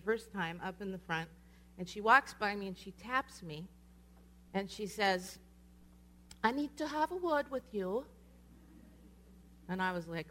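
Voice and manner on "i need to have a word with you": put-on high-pitched English accent, rising at the end. English speaker taps her during worship, high English accent. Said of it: "says it again in this really pleasant English accent".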